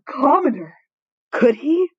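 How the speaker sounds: hammed-up gasp and wail; dramatic horror. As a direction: gasp then loud high crying out. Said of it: "I hope that was appropriately dramatic".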